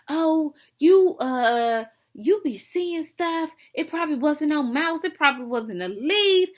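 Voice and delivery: mock her dismissive doubting, voice lifted; mocking sister imitation. Retells little sister doubting her.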